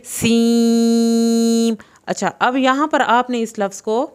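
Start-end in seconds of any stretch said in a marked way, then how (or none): 0.1-1.7 "سیم" with a sibilant s and long level vowel; whistling seen. Shown as a demonstration. Teacher showing the whistle sound of seen, elongated "سیم". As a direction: hiss the s, then hold "سیم". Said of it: "سین کا sound سیٹی کی آواز کے ساتھ ہے".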